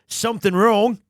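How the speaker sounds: put-on Aussie voice; Australian accent. Player auditioning the samurai actor as an Aussie, accented. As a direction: say it as a broad Australian. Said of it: "Or is he an Aussie?".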